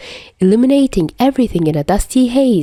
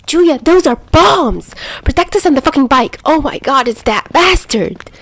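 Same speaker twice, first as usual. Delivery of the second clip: panicked shouting; 8 semitones higher than usual. Acted. Albatross warning Chuya, panicked shout. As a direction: yell it while dragging him to the bike.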